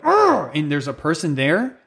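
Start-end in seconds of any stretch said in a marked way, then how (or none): 0.0-0.5 frustrated growl. Retold or imitated. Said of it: "you feel like".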